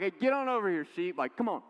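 loud, high, fast shout calling sheep over; a loud farmhand calling the flock; mock shout. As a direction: holler at the sheep to get over here. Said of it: "not trying to shout at the sheep".

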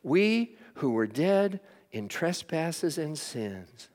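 ordinary talk, nothing marked

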